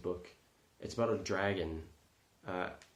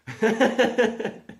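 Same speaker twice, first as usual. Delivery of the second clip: loud high burst of laughter; 14 semitones higher, 13 dB louder than usual. Real, not acted. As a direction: crack up loudly. Laughing at tire gift.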